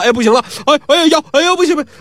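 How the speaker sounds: high-pitched, hammed-up yelps of pain; exaggerated moaning, voice climbing; feigned agony. A narrator's reading. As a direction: cry out as if the back has given way. Feigns worsening back pain.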